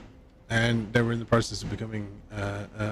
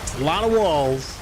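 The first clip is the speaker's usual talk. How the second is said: higher-pitched mock politician's voice; put-on presidential impression, pitched up; Trump; 6 semitones higher than usual. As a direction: impersonate a boastful American politician. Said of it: "Sound a bit like Trump now".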